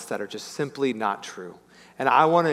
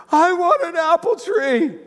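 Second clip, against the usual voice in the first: very high, loud, slow wail; hammed-up wailing of an upset little boy; mock crying child; 13 semitones higher, 5 dB louder, slower than usual. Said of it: "he's just crying. He's so upset".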